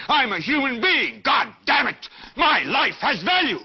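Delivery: high, ringing shout of defiance; fervent, swooping preacher's shout; impassioned outcry. Acted. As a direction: cry out each phrase like a rallying call.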